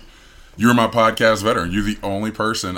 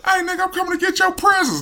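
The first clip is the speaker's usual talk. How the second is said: mock gangster Grinch. Grinch threatening the Who's, high mock gangster voice. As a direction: pitch it way up, comic gangster menace. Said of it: "sound like fucking nineties gangsters".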